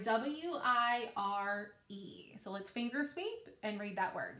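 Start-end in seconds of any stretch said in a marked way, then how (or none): 0.0-2.4 W I R E spelled letter by letter, slow; teacher spelling W I R E aloud; spelling slowly. Shown as a demonstration. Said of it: "we spell wire".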